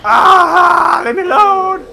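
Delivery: high, loud panicked cry; terrified shout. Real, not acted. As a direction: yell to be let go. Grabbed by the monster.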